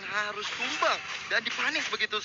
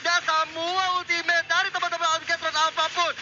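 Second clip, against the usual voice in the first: esports caster; 8 semitones higher than usual. Real, not acted. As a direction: call the teamfight like a caster at peak. Hype call of teamfight ultimates. (caster esports yang bersemangat)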